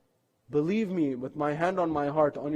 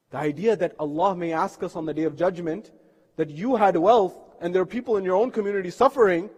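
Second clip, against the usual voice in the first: ordinary talk, nothing marked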